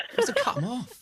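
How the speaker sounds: high flustered blurt. Real, not acted. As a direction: blurt it, caught out. Flustered apology.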